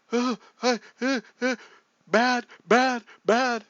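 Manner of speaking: high, loud acted panting; hurt and winded. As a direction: gasp out each word like a wounded runner. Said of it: "they can tell he's hurt".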